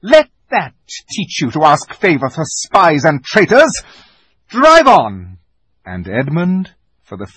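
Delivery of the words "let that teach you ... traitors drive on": loud contemptuous command; witch queen voice. A narrator's reading. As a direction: snap the order and leave. Witch strikes Edmund, departs.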